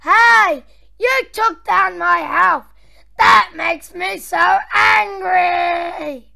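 theatrical villain indignation; play-acted outrage. Boss fuming over his stomped friend, play-acted outrage.

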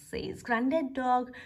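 ordinary delivery, nothing marked

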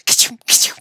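loud, explosive mock sneeze; acted sneeze. Joe imagining Billy sneezing on pepper, explosive mock sneeze. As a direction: burst out with a fake ka-chow. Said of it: "cried Joe".

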